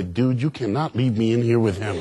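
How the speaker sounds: low, urgent comic appeal; hushed mock plea. Son begging the vice principal to stay, low mock plea. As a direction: confide dread to a would-be rescuer.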